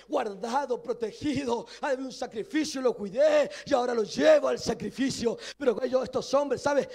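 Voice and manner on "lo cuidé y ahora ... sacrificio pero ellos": ordinary delivery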